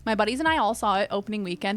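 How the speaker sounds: ordinary talk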